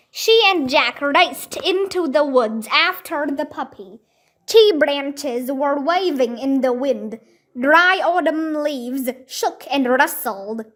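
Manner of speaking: ordinary delivery, nothing marked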